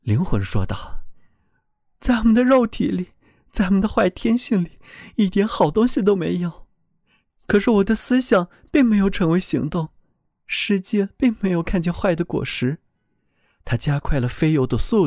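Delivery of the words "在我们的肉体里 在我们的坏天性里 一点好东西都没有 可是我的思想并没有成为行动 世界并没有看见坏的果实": higher, earnest voice of a frightened soul; pleading soul voice. A narrator's reading. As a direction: speak up as a soul defending itself.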